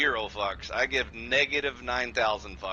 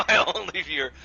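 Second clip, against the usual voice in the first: laughing; 7 semitones higher, 5 dB louder, faster than usual. Real, not acted. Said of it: "I was your only view".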